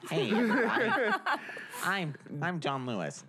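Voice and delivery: failed host impression, breaking into laughter; John Lewis. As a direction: badly fake a show opener while giggling. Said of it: "No, that's not his voice".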